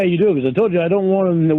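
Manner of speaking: normal talking voice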